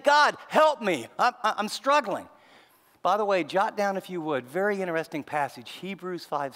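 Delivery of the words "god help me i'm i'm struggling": louder, slower cry for help. Retold or imitated. The psalmist, overwhelmed, cries out loud to God for help. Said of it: "he's crying out loud".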